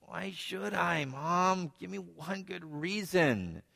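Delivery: just woken up and unwilling; groggy. Retold or imitated. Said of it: "Her son groggily looks up".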